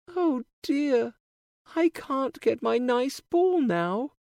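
put-on high, bright girlish princess voice; cartoon princess. A narrator's reading. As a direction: play a sweet young princess. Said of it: "says the princess".

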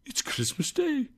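soft, high, wondering; to himself. A narrator's reading. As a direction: murmur it in wonder. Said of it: "said Scrooge to himself".

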